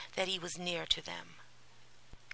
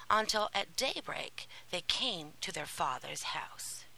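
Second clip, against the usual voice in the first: ordinary delivery, nothing marked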